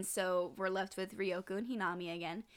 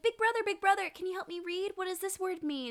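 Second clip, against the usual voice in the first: put-on high-pitched pleading child voice; eager little girl; 10 semitones higher than usual. Little girl asking big brother for reading help, eager. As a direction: pipe up like a kid wanting help.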